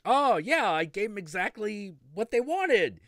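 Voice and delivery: chirpy, self-satisfied dealmaker. Retold or imitated. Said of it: "they were like".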